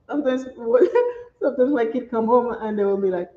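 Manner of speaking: normal talking voice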